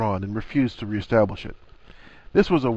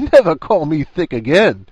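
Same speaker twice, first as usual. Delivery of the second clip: loud, higher-pitched crowing; raised voice, gleeful jibe; triumphant teasing; 5 semitones higher, 7 dB louder than usual. A narrator's reading. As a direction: crow it loudly at a friend's expense. Ron laughing at Hermione, teasing.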